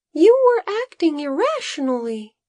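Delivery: high, light little assistant voice; a small worried helper explaining; Bodo's high voice. A narrator's reading. Assistant telling the farmer his master is affected, high voice. Bodo speaks as a character.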